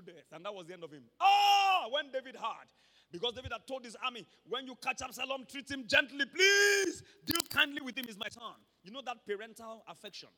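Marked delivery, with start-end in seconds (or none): 4.5-8.5 king David's loud, high pleading order. Acted. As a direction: beg them to spare his son. David's order about his son.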